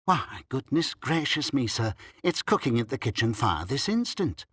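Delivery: ordinary delivery, nothing marked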